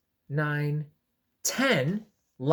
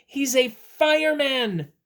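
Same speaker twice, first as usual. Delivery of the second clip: excited; 10 semitones higher, 7 dB louder, slower than usual. Real, not acted. Excited reveal.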